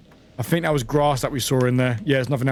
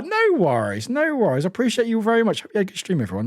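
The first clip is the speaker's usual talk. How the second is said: ordinary talk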